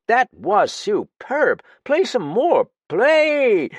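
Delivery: high, loud, slow call. A narrator's reading. Narrator drowning out the knocking, almost shouting. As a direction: cover the knocking with a near shout. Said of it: "very loudly".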